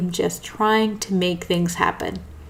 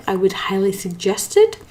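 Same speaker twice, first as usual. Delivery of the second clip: ordinary talk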